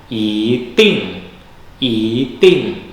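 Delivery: i in i ting said rising, second tone, ting falling; model i ting. Shown as a demonstration. Teacher models yi before fourth tone for students, clearly. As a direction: lift the i, drop the ting. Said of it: "i berubah menjadi nada dua".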